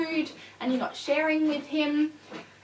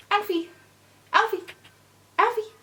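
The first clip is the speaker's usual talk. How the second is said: rising higher call each time; calling his name; 9 semitones higher than usual. Real, not acted. Owner calling puppy name repeatedly, higher each time. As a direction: get his attention.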